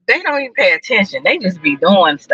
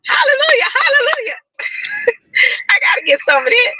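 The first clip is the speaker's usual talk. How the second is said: high, crying-out hallelujah; joyful praise cry; church shout; faster than usual. Real, not acted. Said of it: "I'm talking about".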